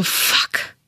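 unvoiced shocked whisper; whispered curse. Shocked inner reaction.

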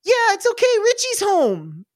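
put-on very high little-kid voice; Iggy. Little brother calling out to parent, high innocent voice. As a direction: blurt it high, drawing out the end. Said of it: "And Iggy, before anybody can stop him, goes".

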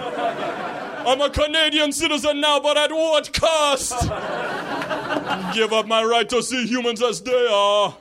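put-on high, lamenting Jamaican-accented yell; Jamaican cab driver. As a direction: rail at fate in a Jamaican accent. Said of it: "I picture Sebastian the Crab and I yell".

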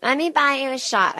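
high-pitched mock drunk fan; imitating drunk audience member. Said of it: "I don't like drunk people coming".

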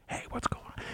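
very soft, secretive whisper. Acted. Adviser leans to the mayor at council, whispering. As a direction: drop right down to a whisper. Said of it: "and whispers".

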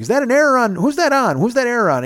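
high-pitched, urgent querying of friends; retold puzzled questioning. Old man asks his friends about the error, puzzled.

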